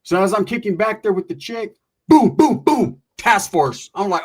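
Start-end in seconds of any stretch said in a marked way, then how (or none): 2.1-3.9 door pounding. Retold or imitated. Cops banging on the door.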